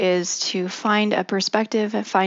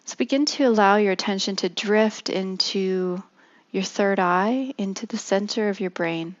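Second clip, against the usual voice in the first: guided meditation. Acted. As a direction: ease them gently into stillness.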